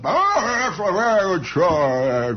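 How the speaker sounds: jovial old chums congratulating each other, overplayed; hammed-up noblemen. Ethelred and Sir Charles parting after battle, hearty mock-noble bluster. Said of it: "Ethelred and Sir Charles meet".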